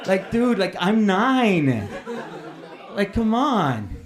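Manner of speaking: high, loud, drawn-out complaint; indignant kid pleading for a break; exasperated protest. Acted. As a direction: whine it in loud, incredulous disbelief. Outraged nine-year-old's reaction to the teacher.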